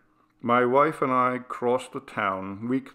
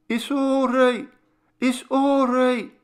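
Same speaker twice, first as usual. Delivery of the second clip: much higher, soothing mother's voice, second take slower; calm the frightened boy as his mother; mother soothing her screaming son, much higher; reassuring mother; 5 dB louder than usual. Acted. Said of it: "she reassured me".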